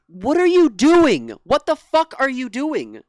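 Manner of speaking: loud, disbelieving what are you doing; exasperated outburst. Real, not acted. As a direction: yell at the screen in real frustration.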